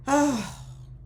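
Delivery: audible exhaled sigh; heavy sigh. Real, not acted. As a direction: let out a sigh. Sighing after listing the trauma.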